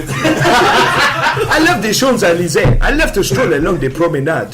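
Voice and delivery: put-on overdone French accent, breaking into laughs; mocking French accent. Showing a friend how they sound, in mocking French accent. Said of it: "That's how you sound".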